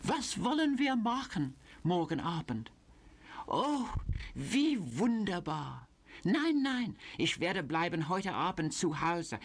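high cooing German, sugary smile in the voice; fatuously sweet. Acted. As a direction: gush sweetly to a lover on the phone. Sally's phone flirting in German.

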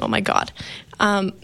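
ordinary talk, nothing marked

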